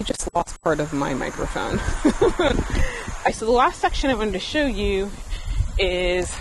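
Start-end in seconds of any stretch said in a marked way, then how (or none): 1.8-3.2 short, high, rising laugh; sheepish laugh at the mishap; embarrassed laugh. Real, not acted. Laughs at losing microphone part.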